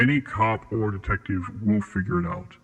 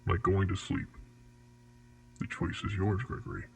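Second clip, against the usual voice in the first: ominous quiet; 8 dB quieter than usual. Acted. Ominous close to the offer.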